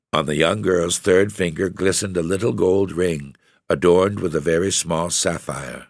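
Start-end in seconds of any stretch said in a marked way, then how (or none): none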